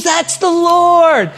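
high, very drawn-out exclamation; excited revelation. Retold or imitated. Wife exclaims like the pastor.

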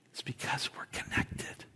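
breathy whisper, almost no voice. Real, not acted. Pastor whispering the heart of it to his church. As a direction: lean in and whisper it like a secret. Intimate aside to congregation.

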